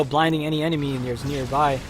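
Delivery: normal talking voice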